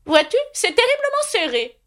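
put-on high, fussy old knight voice; high knightly voice promising to escort Alice. A narrator's reading. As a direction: play the kindly, dotty knight up high.

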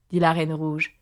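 plain reading voice

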